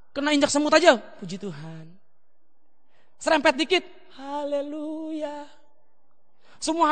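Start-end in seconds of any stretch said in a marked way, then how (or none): 1.0-1.9 hushed, low mock-saintly murmur; exaggeratedly meek praise; mock pious. Mock perfect pastor's pious reaction. 4.2-5.5 soft, long-drawn saintly haleluya; hushed, exaggeratedly serene; mock pious. Mock perfect pastor when slightly bumped.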